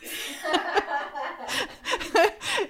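high, sustained giggling; real laugh. Real, not acted. Host amused by his excuse, real giggling. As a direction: crack up helplessly in a high pitch.

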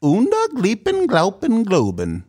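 high, drawn-out gibberish chant; goofy high-pitched gibberish; silly nonsense voice. Pretend. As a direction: stretch out mock-foreign nonsense up high. Nonsense voice before impressions talk.

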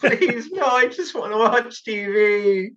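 high-pitched, drawn-out mock begging; mock pleading. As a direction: plead playfully with the nagging voice. Acting out pleading with inner voice.

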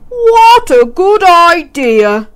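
drawn-out delighted agreement; Zog's eager voice. A narrator's reading. Zog answering the girl, slow and pleased. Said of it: "said Zog".

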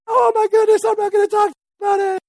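high, squeaky mock shy voice; shy person.

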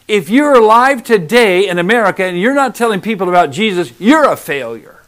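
loud, impassioned preacher pressing the point; fiery sermon warning of hell; preaching at full force. Acted. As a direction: drive it home to the congregation, loud. Preaches hard at congregation.

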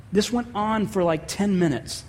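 normal talking voice

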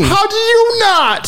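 high, slow, far-off mock whine; mocking echo. Real, not acted. Teasing a friend by echoing his rant, mocking. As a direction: parrot his words back to tease him.

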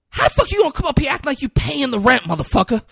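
high, fast, loud indignant yell; favorite line. Retold or imitated. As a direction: fire it off at the cops, outraged. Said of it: "one of my favorite lines".

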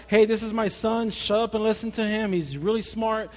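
loud, brusque mock God; mock blunt God. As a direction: bark it out as a rude mock God. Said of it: "Or he doesn't say".

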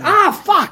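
sudden high yelp of pain. Retold or imitated. A burned hand brushes the stalk, yelping. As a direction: yelp like the sore hand hit something. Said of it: "You're like".

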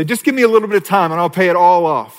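quick, high mock plea; pleading sinner. Sinner bargaining with God, mock pleading voice. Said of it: "we come to him and say".

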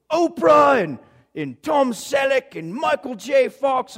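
high, emphatic name-dropping in a put-on accent; Charles Siegel. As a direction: reel off names in a butchered accent. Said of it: "got this incredible accent".

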